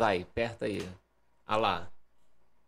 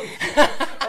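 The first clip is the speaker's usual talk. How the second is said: risadinha aguda; 10 semitones higher than usual. Retold or imitated. Mimics girlfriend with duck.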